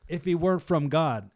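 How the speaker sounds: high, loud incredulous question; mock annoyed. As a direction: argue it as if exasperated and dumbfounded.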